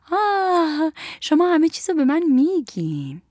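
high, sugary coaxing after a little laugh; sweetly smiling. Acted. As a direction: coax with a sweet smile. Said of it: "با لبخندی شیرین گفت". (با لبخندی شیرین)

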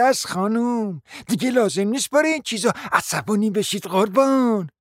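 lightly voiced, sanctimonious; mild false piety; a hint of a pious guest. Guest preaching patience to the hostess.

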